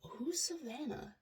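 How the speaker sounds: hushed puzzled whisper. Retold or imitated.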